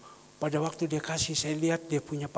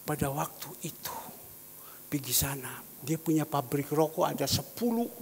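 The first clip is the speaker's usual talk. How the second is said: ordinary talk, nothing marked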